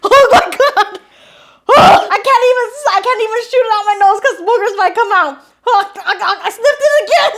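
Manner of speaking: giggling helplessly mid-mess; laughing. Real, not acted. Ice cream up the nose, laughing helplessly.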